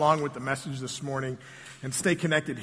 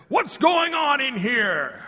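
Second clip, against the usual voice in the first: stern, slow preacher boom, pitched high; bursting in on the kids, playing the stern preacher; sternest preacher voice; 8 semitones higher, 4 dB louder, slower than usual. Preacher bursting in on the children, stern mock boom. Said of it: "in my sternest preacher voice".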